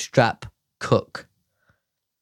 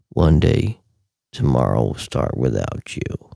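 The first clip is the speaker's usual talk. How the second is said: tweeting alter ego voice; 13 semitones lower than usual. Shown as a demonstration. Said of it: "I do it in that voice".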